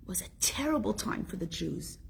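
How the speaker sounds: hushed, grave whisper. Acted. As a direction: drop to a whisper for the dark part. Solemn whispered point in sermon.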